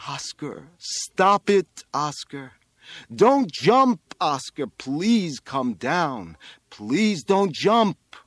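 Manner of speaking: desperate plea, loud bursts on the commands; pleading. A narrator's reading. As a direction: beg him slowly to come down. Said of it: "he pleaded".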